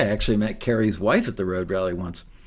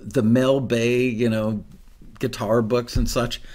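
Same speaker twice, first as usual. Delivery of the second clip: usual speaking voice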